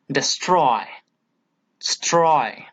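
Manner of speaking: "destroy" with STROY leaned on, then repeated alone; stress on second syllable. Shown as a demonstration. Teacher isolates the stressed part for students, stress on STROY. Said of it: "is given importance here".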